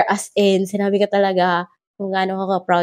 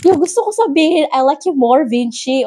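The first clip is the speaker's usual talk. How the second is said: giddy fan gush; 7 semitones higher than usual. Retold or imitated. Fan gushing to idol.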